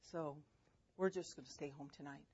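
soft, low, calm mom voice; gentle refusal without yelling. Retold or imitated. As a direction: quietly state the consequence to the child. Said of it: "Didn't have to yell".

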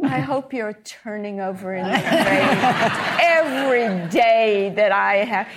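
voiced thought, building to a loud finish; gloating imagined thought. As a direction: relish every word as a triumphant jab. Acts out widow's gloating thought.

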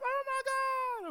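very high, drawn-out cry of shock; mock panicked wail. Wife hearing the shooting was next door, mock panicked wail. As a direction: wail it slowly, high and loud.

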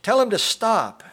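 very high, loud desperate plea; woman's screech. A narrator's reading. Woman begging the crowd to halt the shooting, screeching. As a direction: screech it as an urgent demand. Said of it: "A woman screeched".

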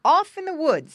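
put-on high, loud pig-boy voice; older boy pig. A narrator's reading. Older pig suggests the woods, high and eager. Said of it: "spoke his brother".

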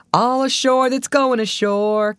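high, slow, carrying call to all aboard; warning cry. A narrator's reading. Ship's crew calling visitors ashore, slow carrying cry. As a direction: call it out across the deck, drawn out. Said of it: "came the warning cry".

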